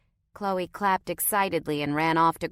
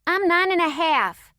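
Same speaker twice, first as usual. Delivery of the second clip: put-on much higher, louder proud kid; indignant child; 12 semitones higher, 8 dB louder than usual. A narrator's reading. Girl correcting her brother about her age, indignant. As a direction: insist on the half like it matters. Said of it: "Chloe said indignantly".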